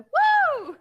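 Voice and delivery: a high, loud woo; mock cheer. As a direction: shriek a celebratory woo. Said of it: "I'd be like".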